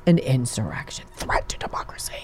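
hushed spooky whisper; sarcastic whisper; mock whisper. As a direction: breathe it like a ghost story. Mock whispered scary phrase.

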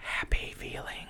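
whispered. Real, not acted. Wistful aside about favorite movie.

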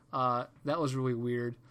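usual speaking voice

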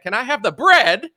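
"bread" blared high and loud; absurd emphasis. Acted. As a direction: punch "bread" like a dramatic headline. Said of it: "I emphasize the word".

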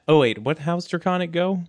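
usual speaking voice

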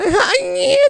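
very high, loud squeal of a laugh; squeaky laugh imitation. Comedian imitates the heckler laughing like a dog toy. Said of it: "så varje gång hon skrattar".

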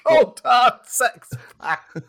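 slow booming announcer voice; dramatic announcer. Pretend. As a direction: stretch every word out like a trailer. Repeats catchphrase high and slow.

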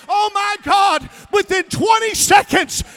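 loud, high, jubilant preaching; preaching climax. Acted. Preacher celebrating the man's deliverance, shouting. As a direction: lift the room with the victory.

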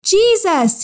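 high, falling call for help; crying out. A narrator's reading. Blind man to Jesus over the crowd, crying out. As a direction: cry the name out high, without shouting. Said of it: "he cried out".

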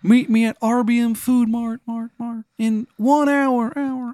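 put-on high Maryland accent, words echoing away; Shithead Steve's echoing voice. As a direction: imitate a fading echo in a bad Maryland accent. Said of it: "you hear his voice".

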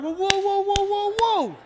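wife's shouting back, imitated high and loud; hammed-up wail in a rising row; mock argumentative yelling. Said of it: "my wife ain't no punk".

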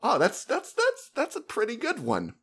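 impressed reaction. Acted. Player reacting to the villain reveal, impressed, high-pitched. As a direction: lift the voice high, as if pleasantly impressed.

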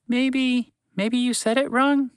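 high, timid little mouse; small mouse voice. A narrator's reading. Mouse to horse, unsure suggestion in a high mouse voice. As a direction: squeak it out gently like a tiny mouse.

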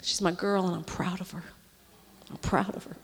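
soft, tearful and quiet; tender pride. Real, not acted. Preacher praising her assistant, softly tearful. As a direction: let the pride catch in your throat.

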